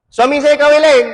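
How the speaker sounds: high, indignant outburst; angry wife. Acted. Wife tells ustaz her husband married another, angry. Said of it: "Oh, marah dia".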